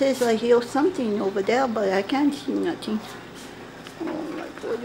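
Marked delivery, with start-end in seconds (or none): 4.0-4.8 soft whispered panic; whispered dread. Acted. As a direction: breathe it out in a scared whisper. Frightened while hiding.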